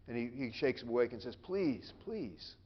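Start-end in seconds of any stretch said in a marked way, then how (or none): 1.4-2.6 soft, higher-pitched plea; pleading. Acted. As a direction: plead quietly, letting it fade. Jesus waking disciples, pleading.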